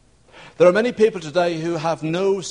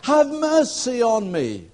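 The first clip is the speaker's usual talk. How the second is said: high, drawn-out plea; almost screaming; 8 semitones higher, slower than usual. Shown as a demonstration. Beggar begs Jesus for mercy, almost screaming. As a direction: cry out slowly at a raised pitch. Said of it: "means almost to scream".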